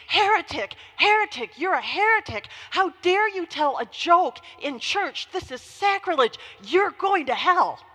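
high, loud, outraged accusation; imitated scream. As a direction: scream it like a scandalized churchgoer denouncing the pastor. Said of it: "She screamed".

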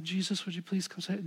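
a soft mumbled request, barely voiced; mumbling under the breath. Preacher mimics a timid mumbled prayer, soft. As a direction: mutter it quietly to yourself. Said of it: "mumbling under their voice".

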